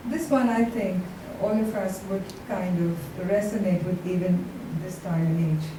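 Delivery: normal talking voice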